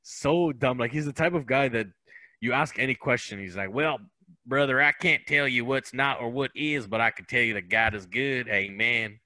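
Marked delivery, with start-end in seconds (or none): 3.7-9.2 imitated Southern good-old-boy accent; Southern churchgoing know-nothing accent; North Carolina accent. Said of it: "who speaks exactly like that".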